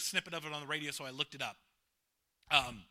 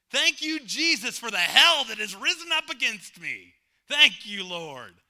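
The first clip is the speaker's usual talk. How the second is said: 1 fervent praise; 3 semitones higher, 9 dB louder than usual. Acted. Preacher ironic praise.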